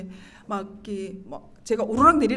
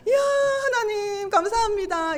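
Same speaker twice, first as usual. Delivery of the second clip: joyful thanks; 9 semitones higher than usual. Retold or imitated. Retold thanks to God.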